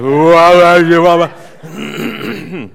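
a big, loud clearing of the throat right into the mic; booming throat clear. Real, not acted.

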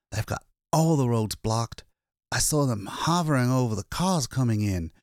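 a hunted man finally safe, still shaking; shaken exhausted man. Acted. Lois tells the attendant of his escape, frightened.

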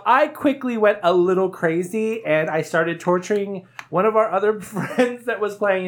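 usual speaking voice

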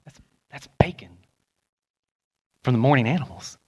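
hushed imitation of an impressed roommate; retold awed whisper about the radio host; mock whisper. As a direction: whisper it like sharing a secret. And he'd go.

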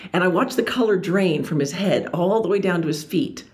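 normal talking voice

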